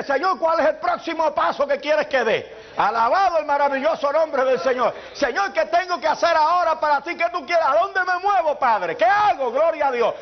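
pleading prayer. Pretend. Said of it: "y pon tus ojos en ese día y dile".

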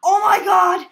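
very loud, high cry of fright; alarmed shout. Real, not acted. Player spots creepy Yoshi man coming, alarmed shout. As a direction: burst out loud in sudden alarm.

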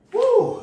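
high whoop of triumph; excited whoop. Real, not acted. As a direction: let out a big high woo. Whoop ending a workout set.

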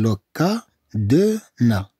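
"لو كا دو نا" in slow separate syllables; slow teacher's sounding-out. Shown as a demonstration. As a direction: pause between each syllable. Slow take for learners.

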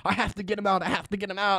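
retold frantic insistence. Possessed guard insists on getting him out, mildly frantic. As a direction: repeat it urgently, only half acted. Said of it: "started going fucking crazy".

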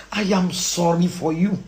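slow, low delivery; pitying statement. Real, not acted. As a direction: spell it out slowly. Slow statement before hushed repeat.

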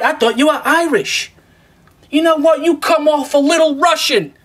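mock viewer guessing, pitched well up; imitated viewers. Viewers guessing his nationality, imitated in a high voice. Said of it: "you guys thought".

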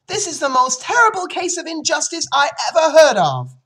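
very high, loud outburst; outraged child. Acted. Fern accuses her father of injustice, high outraged shouting. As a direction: shout it with full indignation.

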